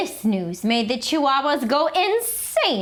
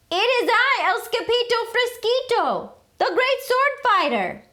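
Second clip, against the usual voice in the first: high, grand swashbuckler voice; heroic boast; 13 semitones higher than usual. A narrator's reading. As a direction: announce yourself like a hero. Hero boasts.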